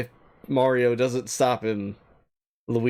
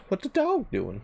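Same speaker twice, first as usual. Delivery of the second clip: silly high voice; 8 semitones higher than usual. Pretend. Voicing the dog on stream.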